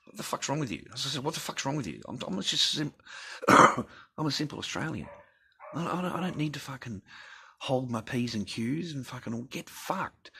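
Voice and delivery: high-pitched retold rant, a mock angry squeal; half-hammed retelling of his own rant; mock indignant outburst.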